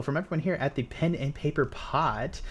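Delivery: usual speaking voice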